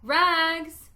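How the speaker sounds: ringing street vendor's call; ragman's call. Acted. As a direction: call it out loud and clear down the alley. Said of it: "calling in a clear tenor voice".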